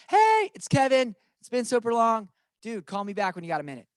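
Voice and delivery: casual voicemail. Shown as a demonstration. Agent leaving a friend a short casual voicemail. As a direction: leave a quick friendly voicemail. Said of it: "You can leave voicemail".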